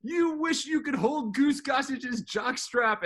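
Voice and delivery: high, slow, drawn-out taunt; retold yelling. Drunk fan belittling the relief pitcher, yelling taunt. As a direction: heckle it high, stretching the words. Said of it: "And I'm, like, yelling".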